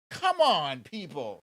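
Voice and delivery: exasperated loud plea. Real, not acted. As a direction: throw up your hands, loudly. Exasperated at anti-vaxxers.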